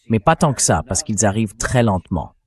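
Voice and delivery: normal talking voice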